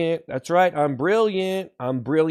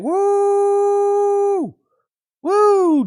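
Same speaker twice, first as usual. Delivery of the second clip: excited cheer; 6 dB louder than usual. Acted. Hype cheer.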